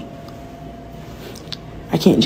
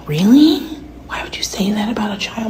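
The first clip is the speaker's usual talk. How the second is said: mock shocked whisper with high Really; whispered outrage; 3 semitones higher than usual. Imitating outraged people, mostly whispering. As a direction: whisper the disbelief. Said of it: "the rest of y'all are like".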